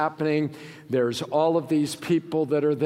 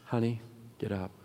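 soft, low, gentle call; tender command; 4 semitones lower, 9 dB quieter than usual. Acted. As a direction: wake a sleeping child kindly. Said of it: "here's what he does".

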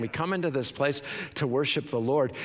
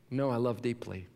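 quiet reply; 4 semitones lower, 5 dB quieter than usual. Acted. Quiet answer after mock question.

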